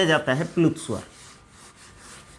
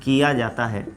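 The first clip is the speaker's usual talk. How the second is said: slow, low-key dictation with long pauses; 6 semitones lower, 7 dB quieter, slower than usual. Acted. Teacher dictating the pluta vowel definition, measured dictation. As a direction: dictate it phrase by phrase, leaving writing time.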